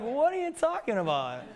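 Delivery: high-pitched baffled retort; comic disbelief. Retold or imitated. Presenter answering the front-row lady, high-pitched disbelief. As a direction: protest as if the remark is absurd.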